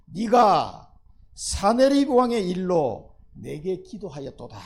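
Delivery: loud, very slow proclamation, stretching each word; solemn loud declaration; booming divine words. Shown as a demonstration. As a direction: thunder it out as God's voice. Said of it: "네가 사네비, 사네립 왕의 일로".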